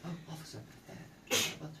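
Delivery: super polite. Retold or imitated. Nervous monk explaining himself to police, super polite. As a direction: tiptoe politely round the officer. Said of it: "You start to be this super polite person".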